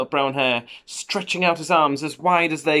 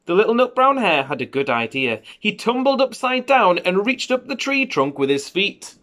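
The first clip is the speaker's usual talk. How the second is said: unhurried picture-book narration with lifted key words; children's story reading; 6 semitones higher, 5 dB louder than usual. A narrator's reading. Parent reading a bedtime hare story to a child, storytelling. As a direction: read it to a little child, savouring the phrases.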